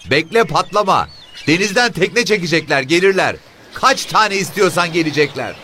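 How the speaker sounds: high, loud shrill woman's voice; woman shouting. Acted. Woman shouts at the officer to be patient, high shrill shout. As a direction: yell back sharply, telling him to wait. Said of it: "Kadın tekrar bağırdı".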